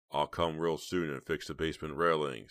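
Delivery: plain reading voice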